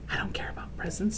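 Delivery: soft whispered line. A narrator's reading. Boy telling parents quietly, whisper. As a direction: say it small and hushed. Harold speaks softly.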